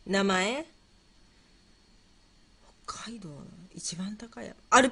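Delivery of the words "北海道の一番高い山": very soft, whispered pondering; murmuring to self. Real, not acted. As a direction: whisper the question to yourself. Murmurs the question while thinking. (ひそひそと独り言)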